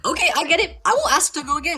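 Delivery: high, panicked backing down; terrified. Retold or imitated. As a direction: plead high-pitched, like someone about to be hit. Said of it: "I was terrified".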